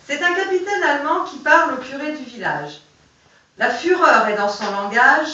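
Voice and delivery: measured poem recital; reciting a poem aloud; poem reading. Acted. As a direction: read the verses steadily to the group.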